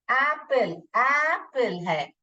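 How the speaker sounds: ordinary delivery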